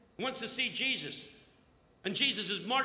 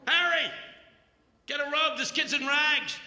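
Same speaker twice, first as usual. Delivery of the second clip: loud, higher eager commands; excited orders; 5 semitones higher, 5 dB louder than usual. Retold or imitated. Overjoyed father ordering a servant to fetch a robe, loud. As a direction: bark joyful orders to the servant.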